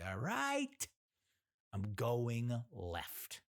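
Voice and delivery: high outburst, then very slow words; exasperated mock compliance. Driver answering the pushy volunteer, exasperated. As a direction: give in with exaggerated, drawn-out words.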